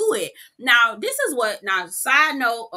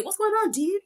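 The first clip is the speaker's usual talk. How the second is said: high, chill bro voice; lightly voiced surfer-bro greeting; a high laid-back dude voice; 9 semitones higher than usual.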